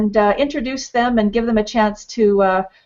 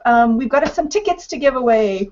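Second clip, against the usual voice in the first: ordinary talk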